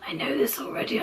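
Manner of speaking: whispered retort. Retold or imitated. Patient retelling reply to dentist, in a whisper. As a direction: hush it like an aside. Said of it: "And I was like".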